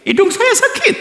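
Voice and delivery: high squeaky whine of a patient; whiny patient. Acted. Patient whining high to the doctor about his nose.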